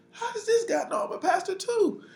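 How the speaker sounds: very high, squeaky disbelief; hammed-up bewildered whine; comic bafflement. Baffled aside in retold encounter.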